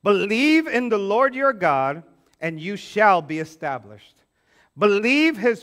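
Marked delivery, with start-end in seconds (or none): none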